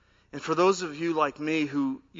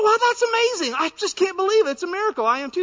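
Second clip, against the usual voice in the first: high, loud delighted cry; hammed-up joy, much higher; overjoyed; 8 dB louder, faster than usual. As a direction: squeal with delight at the match. Overjoyed man in joke.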